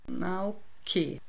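slow, drawn-out giving in; reluctantly amused, stretched-out agreement; grinning concession. A narrator's reading. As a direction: give in slowly, with a grin. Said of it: "lenkt er grinsend ein".

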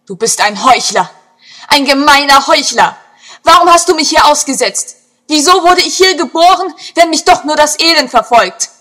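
loud, high, desperate outburst at the heavens; verzweifelter Schrei. A narrator's reading. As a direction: scream accusations up at an unfeeling sky. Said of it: "schrie sie zum Himmel". (verzweifelt zum Himmel schreien)

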